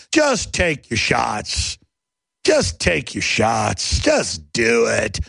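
sarcastic slow just do it; mock slow command. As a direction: drawl the order out mockingly. Mocking officials' advice.

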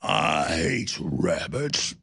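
very low, slow cartoon cowboy; exaggerated Texas accent, deep and dragged out; Yosemite Sam. Host showing off his impression, deep slow Texan cartoon voice. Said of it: "I can do Yosemite Sam".

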